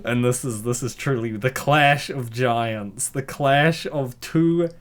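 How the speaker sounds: slow, drawn-out grand announcement; mock epic announcer. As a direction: boom it like a fight announcer.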